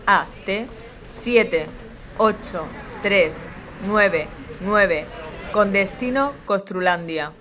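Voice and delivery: calm, even last-call PA read; airport announcement. Acted. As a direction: read it like a terminal boarding call. Airport final boarding call.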